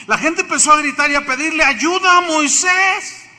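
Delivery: rising to a loud call over the panic. Acted. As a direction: pitch it up and call it out. Preacher builds to the people's cry.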